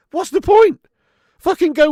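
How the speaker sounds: high, loud outburst of disbelief; exasperated shout. Real, not acted. Streamer ranting at chat about England's lineup, exasperated shout. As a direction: throw the question out in frustration. Ranting at manager's team selection.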